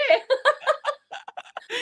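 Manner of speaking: high-pitched laughing giggle. Real, not acted. Laughing at own honk.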